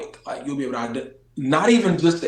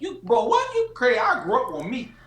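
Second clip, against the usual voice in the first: incredulous outburst; 11 semitones higher than usual. Real, not acted. Indignant at his friend for doubting [name], high incredulous. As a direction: scoff at the friend, bro what.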